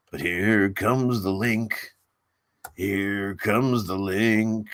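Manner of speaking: very slow, stretched-out words, like a lagging recording; mock slow motion. Shown as a demonstration. Streamer joking about his slow laptop, exaggerated slow-motion voice. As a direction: crawl through it as if the laptop is lagging. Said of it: "Everything's slower on this laptop".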